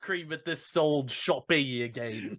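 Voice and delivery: exaggerated Cockney complaint; Cockney accent. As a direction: grumble like a mock Cockney customer. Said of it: "speaks with a Cockney accent".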